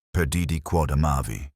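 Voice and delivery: plain reading voice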